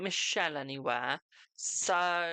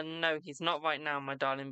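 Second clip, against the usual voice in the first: ordinary delivery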